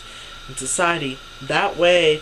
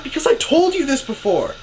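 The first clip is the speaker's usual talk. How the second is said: high-pitched, insistent comeback; retold indignant protest; exasperated retort; 10 semitones higher, 4 dB louder than usual. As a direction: argue back in a raised pitch.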